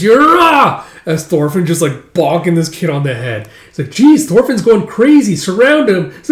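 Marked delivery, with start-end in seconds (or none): 0.0-0.8 high, loud charging yell; whooping war cry; battle cry. Acted. Child charging in a play battle. 3.9-6.1 high, loud playground alarm; excited shout to the other kids; alarmed kid yell. Acted. Kids in play battle raise alarm.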